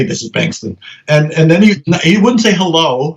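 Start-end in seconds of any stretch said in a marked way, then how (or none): 0.0-0.8 mock-formal low reply; curt self-introduction. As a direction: lower and softer phone reply. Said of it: "and I would say".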